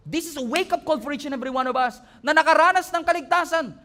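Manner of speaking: high, loud, driving preacher's voice; preaching at full force. Acted. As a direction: preach it hard to the congregation. (nangangaral nang buong lakas at malakas na boses)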